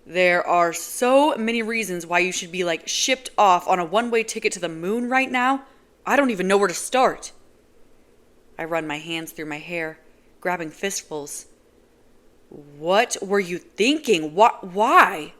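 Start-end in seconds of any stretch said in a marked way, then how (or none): 0.1-7.3 loud exasperated rant; angry rant. Acted. Yelling at Nazira. 12.5-15.3 slow, loud, rising to a yell; angry demand. Acted. Yelling at Nazira.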